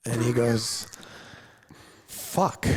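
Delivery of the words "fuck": mock curse. Client's deflated reaction quoted.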